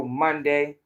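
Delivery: ordinary talk, nothing marked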